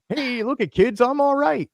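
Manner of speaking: high chirpy put-on reassurance; lightly voiced mascot brushing it off; brave Tigger. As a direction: fake cheer for the kids while hurt. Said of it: "he's trying to do the".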